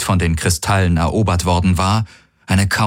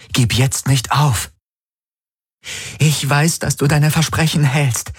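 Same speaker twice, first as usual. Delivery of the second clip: much higher female voice, earnest; Kirai, urging; 8 semitones higher than usual. Acted. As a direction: coax his memory back. Kirai encourages Garlen.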